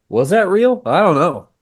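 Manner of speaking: high, loud incredulous questioning; disbelieving. Retold or imitated. Actor doubting the shoot ever happened, high-pitched disbelief.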